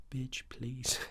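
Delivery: hushed, sassy American "bitch, please"; American accent. Acted. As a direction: whisper it in a put-on American accent. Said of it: "I talk with an American accent".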